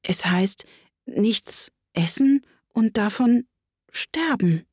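hesitant guess, piece by piece; unsure. Acted. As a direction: feel your way through it, with pauses. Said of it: "sagte Alice unsicher".

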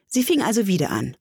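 usual speaking voice

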